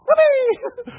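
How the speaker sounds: high, loud sarcastic whoop; mock whoopee. Preacher mocks a family's excuse with a loud whoop. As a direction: cheer it in mock triumph. Mocking cheer in sermon.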